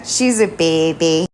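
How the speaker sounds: ordinary talk, nothing marked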